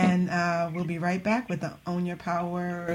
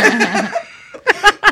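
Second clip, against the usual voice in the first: loud, high laugh bursting out; high burst of laughter; 11 semitones higher, 10 dB louder than usual. Real, not acted. Host reacting to a guest's joke with a loud, high laugh. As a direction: crack up at the joke.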